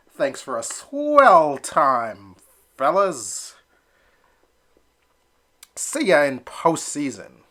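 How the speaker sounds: slow, loud, cheery farewell; breezy sign-off. Acted. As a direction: leave the team smiling. Elmer cheerfully leaves.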